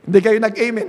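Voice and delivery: "Hindi kayo nag-amen" at full volume. Shown as a demonstration. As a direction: project it clearly to the room. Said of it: "hindi nyo na nga narinig".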